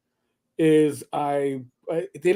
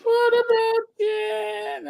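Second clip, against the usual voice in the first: very high, drawn-out mock sitcom kid; exaggerated high-pitched character whine; Brady Bunch Jan; much slower than usual. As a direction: squeal it slowly in a put-on high voice. Brady Bunch catchphrase impression.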